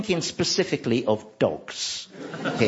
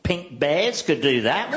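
ordinary talk

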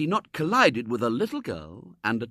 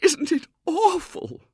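high, slow wail; 8 dB louder, slower than usual. A narrator's reading. Wife to husband, high slow wail. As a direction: drag it out in despair. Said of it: "wailed Missus Brown".

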